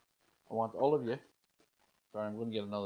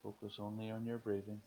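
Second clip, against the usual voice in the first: guided meditation. Acted. Leads a breathing meditation.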